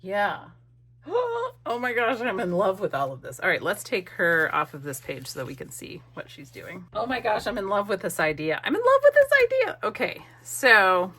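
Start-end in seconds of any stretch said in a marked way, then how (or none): 1.1-1.6 high, quick intake of breath; sharp happy gasp; delighted gasp. Real, not acted. Delighted with her collage idea. 8.6-9.8 much higher, louder and faster burst of delight; high, rushed, loud enthusiasm; excited gushing. Real, not acted. Repeats it, carried away.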